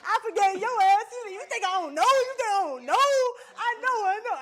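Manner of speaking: high, loud, laughing yell; shouting at her boyfriend, half laughing; shouting at her man. Retold or imitated. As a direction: yell it back at him, laughing. Shouting at ex in retelling.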